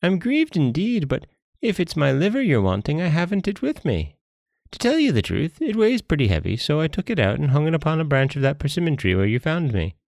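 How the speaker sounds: high-pitched, wily monkey making excuses; apologetic monkey. Acted. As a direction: fib regretfully in a high monkey voice.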